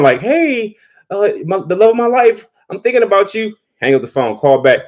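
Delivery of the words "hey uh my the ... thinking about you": put-on high, pleading old man on voicemail; imitating a lovesick old husband; mock old husband. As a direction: put on a frail, doting old man's voice.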